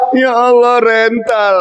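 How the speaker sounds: lightly acted tearful lament; hammed-up sobbing complaint; mock crying. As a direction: wail it like a let-down daughter. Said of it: "anaknya pulang nangis".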